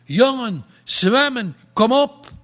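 high, loud rallying shout; calling out. A narrator's reading. Skipper urging the drowning boy to swim, loud shout. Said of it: "die roept".

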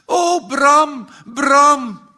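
loud, high anguished cry; high wail of remorse at full volume; screaming out. A narrator's reading. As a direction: scream the name out in distress. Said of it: "Ze gilt het uit".

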